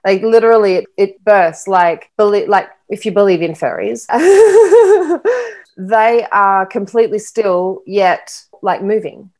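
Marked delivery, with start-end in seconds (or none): 4.1-5.5 a burst of high, loud laughter; high giddy laugh. Real, not acted. To her host about fairies, a high delighted laugh.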